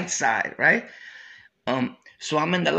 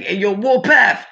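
imitating a guy calling a name, louder; 4 semitones higher, 7 dB louder than usual. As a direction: call "Warpath" across the room. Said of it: "He's like".

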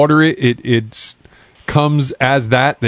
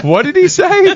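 much higher, louder incredulous squeak; mock bewildered; 4 dB louder than usual. Reacting to the worker's accent, mock baffled squeak. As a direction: act baffled by the strange accent. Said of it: "I was like".

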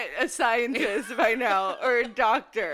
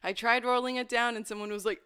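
ordinary delivery

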